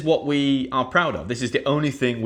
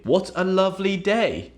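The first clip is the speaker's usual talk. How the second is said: high, pleased delight; warm lovely; 8 semitones higher than usual. Shown as a demonstration. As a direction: savour the lovely day. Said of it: "You could say".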